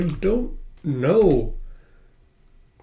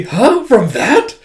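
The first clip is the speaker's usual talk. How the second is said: shocked; 8 semitones higher, 6 dB louder, much slower than usual. Retold or imitated. Tama shocked by reference.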